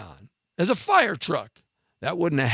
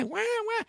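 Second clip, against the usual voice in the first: high, soft mock wah wah; mock baby crying; 6 dB quieter than usual. Mocking the parents who let the kid cry, with soft high wails. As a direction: whimper it like a bratty kid. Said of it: "Let that little kid cry".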